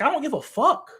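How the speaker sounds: Joe's imagined reply, rising in annoyed force; imagined blunt retort. Retold or imitated. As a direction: voice Joe brushing off the question, fired up. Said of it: "he's like".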